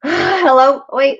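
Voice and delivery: normal talking voice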